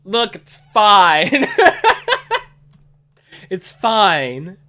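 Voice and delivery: loud, laughing "it's fine", pitch jumping high; cracking up at the flub. Real, not acted. Laughing off his own slip.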